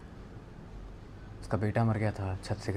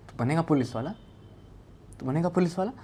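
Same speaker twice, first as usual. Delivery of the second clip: mildly raised, pressing question; insistent demand; 6 semitones higher than usual. Retold or imitated. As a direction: press him for an answer, rising higher on the repeat. Retold bullying while hitting the boy.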